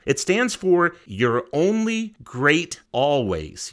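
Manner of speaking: "You're Only Great Always" spelled out slowly, each word weighted; slow and deliberate. Shown as a demonstration. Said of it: "It actually stands for something".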